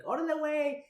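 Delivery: lightly put-on Chicano slang; Chicano accent. Shown as a demonstration. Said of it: "I'd like to turn it up for them".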